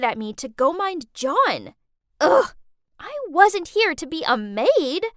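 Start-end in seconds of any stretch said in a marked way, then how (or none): 2.2-2.5 very loud, high-pitched ugh; exasperated groan. Acted. Teen ordered to mind her brother, exasperated groan. 3.0-5.1 raised, higher, louder outburst; indignant protest. Acted. Teen ordered to mind her brother, indignant protest.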